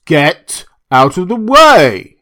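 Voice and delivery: loud, high, slow roar. A narrator's reading. Drunk doctor roaring at Prendick to move, loud and slow. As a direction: roar him out of the way. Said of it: "he roared".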